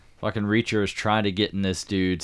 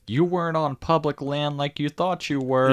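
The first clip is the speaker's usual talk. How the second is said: higher, put-on voice of the stern agent; imitating agent Duffy; 8 semitones higher, 4 dB louder than usual. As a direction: play the tough agent, pitched up. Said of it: "but she's like".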